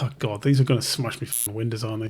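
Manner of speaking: quiet aside to herself, dropping low; muttered worry. Retold or imitated. Tattooist fears customers will smash windows, quiet worried mutter. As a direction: mutter it like a fearful private thought.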